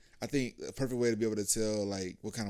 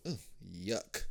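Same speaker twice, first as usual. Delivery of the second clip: low, soft ugh then yuck; grossed out and quiet; soft disgusted ugh yuck; 7 semitones lower, 12 dB quieter than usual. Shown as a demonstration. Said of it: "just go".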